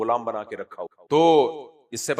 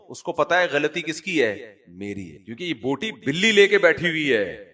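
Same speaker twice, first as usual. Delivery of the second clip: ordinary talk, nothing marked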